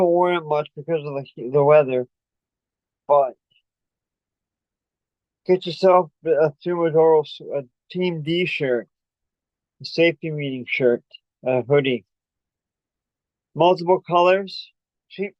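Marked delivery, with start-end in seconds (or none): none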